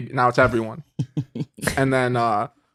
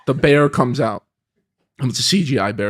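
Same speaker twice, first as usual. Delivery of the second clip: ordinary delivery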